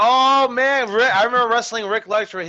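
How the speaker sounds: loud, high burst of recognition; excited recall. Real, not acted. Wrestler recalling a match with Rick Luxury, excited and loud. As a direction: jump in loudly, thrilled to remember. Delighted memory at hearing the name.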